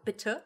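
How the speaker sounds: 'bitte' ending in a soft uh; E schwa. Shown as a demonstration. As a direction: say 'bitte' with a swallowed final e. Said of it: "is an E schwa".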